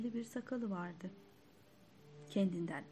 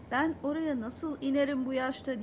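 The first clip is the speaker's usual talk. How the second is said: shouting; 5 semitones higher, 6 dB louder than usual. A narrator's reading. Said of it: "diye bağırdım".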